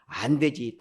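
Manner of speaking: ordinary talk, nothing marked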